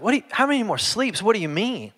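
high, quick confused question; puzzled. Retold or imitated. Dad puzzled by his daughter's sleep question, high and quick. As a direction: sound truly puzzled. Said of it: "I was like".

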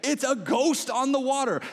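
loud, high, slowed for force; emphatic exclamation. Real, not acted. Emphatic retort to the ghost's cry.

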